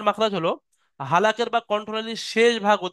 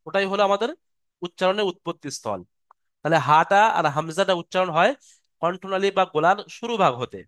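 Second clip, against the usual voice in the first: ordinary talk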